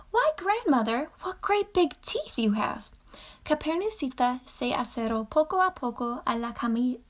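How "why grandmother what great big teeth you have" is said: put-on higher wondering little girl; little girl's voice. A narrator's reading. Girl in front of the disguised wolf, higher wondering voice. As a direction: ask like a curious child.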